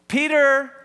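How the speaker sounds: high, loud call of the name. Retold or imitated. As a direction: imitate a mother calling out for her missing child. Mom's second, sharper call.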